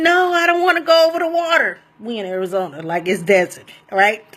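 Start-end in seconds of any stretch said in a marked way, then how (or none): 0.0-1.8 high-pitched panicked no, retelling a terrified scream; reliving the fear of being carried over water; mock panicked scream. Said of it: "So I'm screaming".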